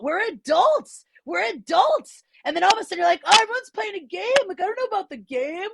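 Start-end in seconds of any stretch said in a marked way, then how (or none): 0.0-2.2 high, slow incredulous We're adults; mock-outraged repetition; exasperated. Real, not acted. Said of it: "exasperated repetition". 3.2-5.7 high, mock-plaintive complaint; playful self-mocking whine; left-out whine.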